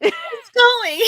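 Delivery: squeaky high voice; cracking voice. Real, not acted. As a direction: voice breaking from the flu. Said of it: "I'm getting my voice back".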